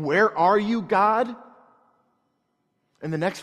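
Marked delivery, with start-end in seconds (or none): 0.0-1.3 raised, pleading call to God; David's cry. Acted. David crying out to an absent God, loud plea. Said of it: "is David saying".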